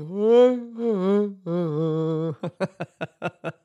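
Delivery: loud burst of real laughter; big real laugh. Real, not acted. Podcaster cracking up at his own yawn idea, loud real laugh.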